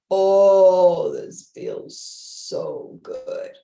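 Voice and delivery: loud "oh" melting into breathy, drawn-out words; blissful relief. Retold or imitated. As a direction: imitate someone sighing slowly into a lovely stretch. Imitates residents enjoying a stretch.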